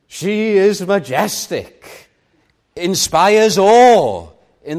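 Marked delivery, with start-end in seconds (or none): 0.1-2.1 IS drawn out slow and higher; awed repetition. Shown as a demonstration. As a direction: savour "She is majestic" slowly, in awe.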